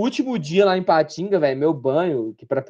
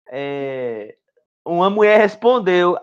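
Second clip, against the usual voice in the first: usual speaking voice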